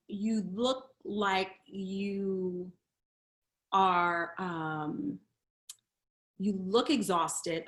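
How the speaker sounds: slow, soft, caring therapist; gentle concern. Retold or imitated. Therapist telling her patient she looks exhausted, gentle. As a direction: say it slowly and tenderly, concerned. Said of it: "And she was like".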